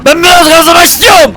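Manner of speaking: frustrated shout. Real, not acted. Wants to speed, frustrated.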